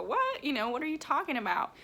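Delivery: mock disbelief, pitch jumping up on What; fast, baffled retort; incredulous. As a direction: imitate friends who can't believe it. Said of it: "and them go".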